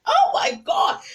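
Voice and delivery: high, crying plea to the bully; pleading, near tears. Retold or imitated. Cornered girl pleads with the ringleader, near tears. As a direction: plead with her, voice breaking. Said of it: "I'm looking at her like".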